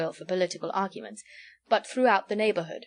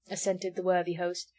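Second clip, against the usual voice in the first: ordinary delivery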